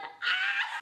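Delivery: high mock shriek with a laugh; a laughing mock scream at the cop's gun; dramatic shriek. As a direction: squeal like it's a horror film. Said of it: "I don't know why I'm being so dramatic".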